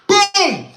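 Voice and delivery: sharp high shouted boom, like a fist on a cell door; loud bang imitation. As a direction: slam the word out as a door bang. Officer bangs on his door.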